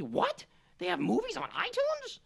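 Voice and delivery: high-pitched, incredulous mocking impression; baffled Eric. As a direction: squeal in clueless disbelief. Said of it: "That's my Eric impression".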